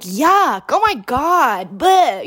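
high, loud, over-the-top grossed-out whine; dramatic complaining. Retold or imitated. Teen overacting disgust at the car smell, dramatic. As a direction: ham up the disgust, gagging on it. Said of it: "being dramatic as hell".

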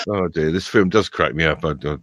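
ordinary talk

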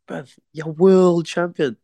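high, slow, stunned voice of his mate; imitating his mate. Mate Spider telling the boxer he's world champion, high, slow and awed. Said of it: "He's like".

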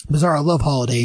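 ordinary delivery

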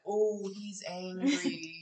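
drawn-out, mocking "he's angry"; slow teasing. Teasing the angry investigator, slow and mocking. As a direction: drag it out to tease him. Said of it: "we'd be like".

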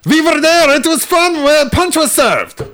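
high, loud mock-German yelling; hammed-up German shouting; angry German accent. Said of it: "you just have to sound very angry".

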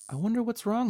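high-pitched wondering; puzzled. A narrator's reading. Puzzled over overheating floor.

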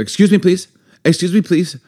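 put-on high-pitched little-girl pestering; imitated little niece. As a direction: play a small child politely badgering an uncle. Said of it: "our little nieces who are the cutest".

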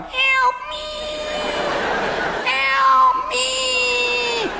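squeaky high cry for help; high thin Fly voice screaming help. Retold or imitated. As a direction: shriek it like the tiny fly in the web. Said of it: "the scene from the movie The Fly".

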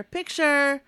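high, loud photographer's call; called-out shout. Retold or imitated. Photographer calls the group to pose, high shout. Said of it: "John starts shouting something".

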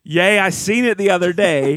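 Republican Jesus. Retold or imitated. A joke about Republican Jesus, lightly voiced and preachy. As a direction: preach it like a folksy prophet. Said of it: "That's Republican Jesus again".

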